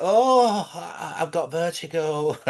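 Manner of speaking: high, slow whiny fear; hammed-up vertigo whine; mock scared tourist. Mocking tourist at height.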